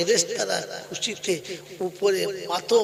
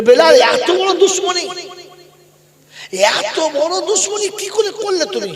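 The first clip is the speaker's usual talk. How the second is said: high, very loud cry of reproach; pained outcry, pitched high and loud; 13 semitones higher, 11 dB louder than usual. Acted. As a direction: call out the accusation at full voice. Prophet reproaches Bilal in dream.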